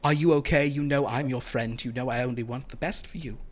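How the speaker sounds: soft, apologetic vampire charmer; soothing vampire. Acted. Vampire reassures charmed Dahlia that it is a friend, soothing. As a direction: speak gently, reassuring a friend under your spell.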